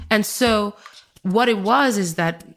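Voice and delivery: ordinary delivery, nothing marked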